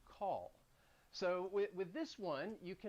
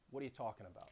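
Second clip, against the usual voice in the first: New York talking, quiet and reduced; New York accent; 5 semitones lower, 8 dB quieter, faster than usual. Shown as a demonstration. Teacher demoing the New York line for learners. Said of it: "with the accent".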